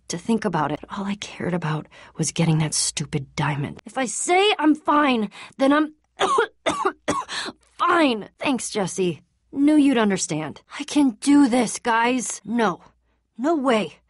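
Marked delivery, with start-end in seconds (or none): 3.8-8.3 insistent. Acted. Insisting while sick. 10.8-14.0 determined. Acted. Insisting he can go on.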